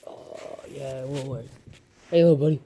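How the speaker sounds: usual speaking voice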